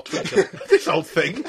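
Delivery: high, laughing repeat. Real, not acted. Laughing at insult.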